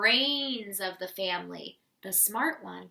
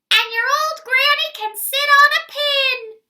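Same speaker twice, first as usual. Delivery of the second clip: much higher, loud mocking yell; pig yelling; 12 dB louder than usual. Third pig yelling insult through door at wolf. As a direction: screech the insult. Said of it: "the third little pig yelled".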